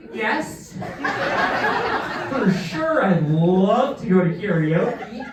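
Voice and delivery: laughing through a fake eager yes; half-laughing, slow mock-keen agreement; forced enthusiasm. To his girlfriend about karaoke, a laughing forced yes.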